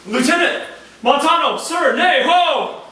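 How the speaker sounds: very loud, high frantic calls; shouting alarm. Acted. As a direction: yell to break up the fight. Iago raises the alarm at the fight.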